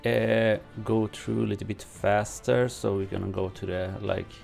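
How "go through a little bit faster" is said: ordinary talk, nothing marked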